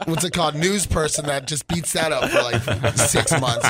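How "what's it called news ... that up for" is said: laughing. Real, not acted. Teasing about Russia talk.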